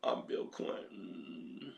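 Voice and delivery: put-on slow, breathy whisper of an old man; mock frail Bill Clinton; feeble Bill Clinton. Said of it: "He can barely talk now".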